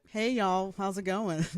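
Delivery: a cheery put-on Southern drawl; Southern accent. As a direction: coworker greeting a customer in a mock Southern accent. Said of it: "I would think it's normal to be like".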